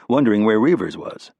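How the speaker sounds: usual speaking voice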